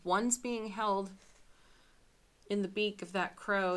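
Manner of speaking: ordinary delivery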